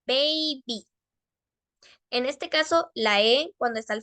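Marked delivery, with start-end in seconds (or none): 0.1-0.9 put-on baby as "bey-bee", both vowels long; pronunciación modelo. Shown as a demonstration. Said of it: "Lo mismo en el caso de abajo". (baby pronunciado con cuidado, "bei-bi")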